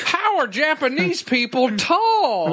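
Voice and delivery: slow, high, amazed TV host; Huell Hauser. Retold or imitated. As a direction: marvel slowly like a folksy host. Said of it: "We just have our own Huell Hauser in here".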